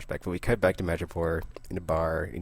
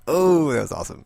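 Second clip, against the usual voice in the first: excited exclamation; 9 semitones higher, 8 dB louder than usual. Retold or imitated. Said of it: "and it's like".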